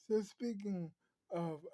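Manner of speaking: ordinary delivery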